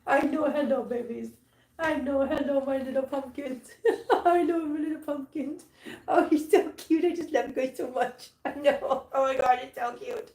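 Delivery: high, adoring voice to kittens. Real, not acted. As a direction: coo and giggle over tiny pets. Cooing to kittens.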